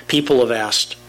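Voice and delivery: usual speaking voice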